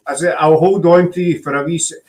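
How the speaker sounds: ordinary talk, nothing marked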